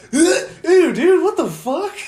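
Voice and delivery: high, loud grossed-out cry; disgusted shriek. Retold or imitated. Friend retelling the text exchange, high disgusted shriek. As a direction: shriek it like you're repulsed. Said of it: "I'm like".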